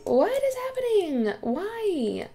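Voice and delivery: shocked at the mess; alarmed. Real, not acted. Vlogger sees another mess, high alarmed cry.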